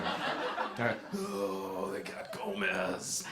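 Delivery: lightly voiced grim general, slow and quiet; a hint of a stoic officer mourning his man; stoic war-movie general. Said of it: "like a general in a war movie".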